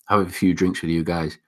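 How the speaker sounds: ordinary talk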